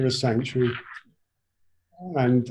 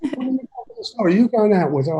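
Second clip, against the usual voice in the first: jealous nosy questioning; 8 semitones higher, faster than usual. Retold or imitated. Jealous people quizzing him.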